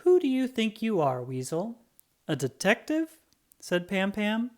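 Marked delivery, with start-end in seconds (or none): 0.0-3.2 high, scoffing know-it-all voice; mocking friend. Pam Pam scoffs at Weasel's dog theory, mocking. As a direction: tease him like a smug girl.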